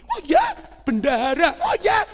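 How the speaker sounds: high, loud acted amazement; surprised father. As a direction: imitate a dad hearing unexpected news. Father reacts to news of son.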